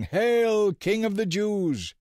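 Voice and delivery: very high, loud mocking shout; mocking yell. A narrator's reading. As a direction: soldiers jeer at the king.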